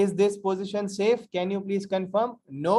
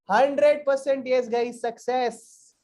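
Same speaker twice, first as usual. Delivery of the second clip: triumphant, drawn-out answer; proud big yes; excited; 5 semitones higher, 4 dB louder, slower than usual. Real, not acted.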